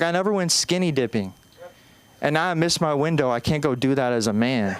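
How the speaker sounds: ordinary talk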